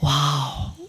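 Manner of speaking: hushed, low wow of wonder; awed wow. Acted. Meditation teacher recalls stunned moments, hushed awe. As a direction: softly act out being stunned. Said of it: "जब हमने".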